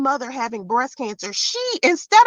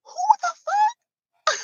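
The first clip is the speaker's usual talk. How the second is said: high, slow, baffled squeal of disbelief; mock confused; 3 dB quieter, much slower than usual. Vlogger retelling her reaction to the troll comment, mock baffled squeal. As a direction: stretch it out in shocked confusion.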